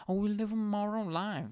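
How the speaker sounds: sanctimonious mockery. Religious tenants boasting of morals, mocked in high voice. Mimicking obsessive religious people.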